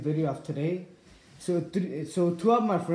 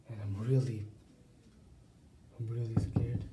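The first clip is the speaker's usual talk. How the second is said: hushed, nervous vlog voice; nervous night vlog whisper; 6 semitones lower, 11 dB quieter than usual. Acted. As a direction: keep it hushed, like ghosts might hear. Hushed nervous vlog after scare.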